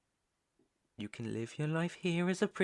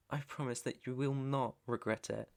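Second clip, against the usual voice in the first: ordinary talk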